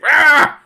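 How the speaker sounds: loud, high monster roar; ogre roar. Acted. Poked ogre shakes the cage, roaring. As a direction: burst out in an angry beast's roar. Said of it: "He's like".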